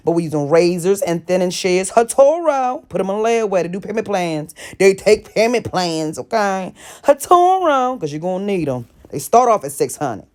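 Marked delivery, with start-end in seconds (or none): 1.9-2.9 brand shout-out. Real, not acted. 7.0-8.0 brand shout-out. Real, not acted.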